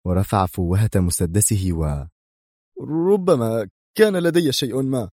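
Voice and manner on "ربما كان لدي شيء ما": high, frightened outburst; terrified cry. A narrator's reading. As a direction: blurt it out in fear. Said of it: "صرخ ماريو بالعبارة في رعب".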